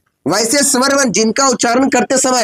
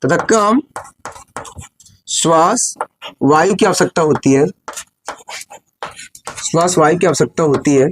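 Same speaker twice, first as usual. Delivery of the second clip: classroom dictation; 6 semitones lower than usual. Real, not acted. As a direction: dictate phrase by phrase, measured and lower. Dictates the definition in pieces.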